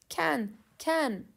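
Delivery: lightly acted double summons; calling out. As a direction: call someone over to the phone. Acting phone call, calling for Ken.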